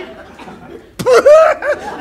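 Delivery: high, loud guffaw; loud burst of laughter. Acted. Kuma cannot hold it in, loud guffaw. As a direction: explode into laughter.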